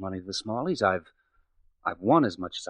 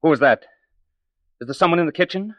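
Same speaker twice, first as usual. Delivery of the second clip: sudden sharp call, louder and higher; startled challenge; 7 semitones higher, 6 dB louder than usual. Acted. Edgar calling into the kitchen, startled and loud. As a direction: snap toward the noise as if caught off guard. Edgar hears someone eavesdropping.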